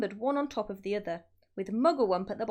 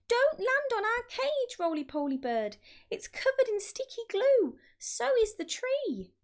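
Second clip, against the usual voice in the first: squeaky little birds calling a warning; high birds' voices; 14 semitones higher, 3 dB louder than usual. A narrator's reading. Birds warn the Roly-Poly Bird, high and squeaky. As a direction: pitch it up high like small birds.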